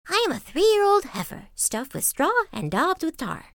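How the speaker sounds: high-pitched singsong ox; straw ox. A narrator's reading. Ox tells the fox what it is, high singsong. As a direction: chirp the answer way up high. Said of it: "said the ox".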